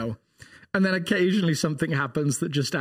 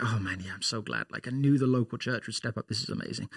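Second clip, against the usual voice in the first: man relieved; 7 semitones lower, 6 dB quieter than usual. Retold or imitated. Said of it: "the man's like".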